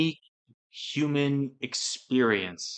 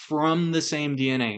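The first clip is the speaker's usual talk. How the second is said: ordinary delivery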